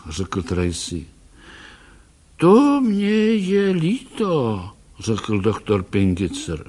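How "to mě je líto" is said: put-on slow, higher old man's voice; gently sorry, an old man consoling; old doctor, sympathetic.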